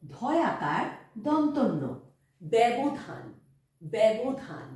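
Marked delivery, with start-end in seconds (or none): 2.4-4.7 ব্যবধান said as "bæbodhan", with an open e; careful ব্যবধান starting with an open "bæ"; clear pronunciation demo. Shown as a demonstration. Teacher showing pupils how initial jofola sounds. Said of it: "বয় জফলা".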